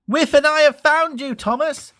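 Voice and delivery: Percy finds his trapped friend. A narrator's reading. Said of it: "peeped Percy".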